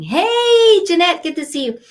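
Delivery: high, loud hello to a viewer; excited greeting. Real, not acted. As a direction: call out the name with delight.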